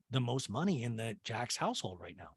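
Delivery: usual speaking voice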